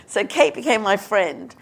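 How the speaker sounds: ordinary talk, nothing marked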